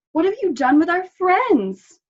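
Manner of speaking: loud, high demand; accusing. A narrator's reading. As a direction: confront him, alarmed. Dog confronts Wolf.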